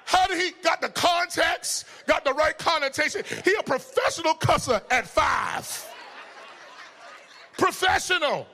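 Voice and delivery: high, punched comic disbelief; scandalized parent playing to the crowd; incredulous outrage. Amazed that five-year-old cusses expertly.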